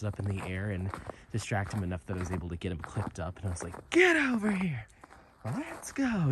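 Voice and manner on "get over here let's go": angry voice. Retold or imitated. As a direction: snap the dog back into line. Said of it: "when I use my angry voice".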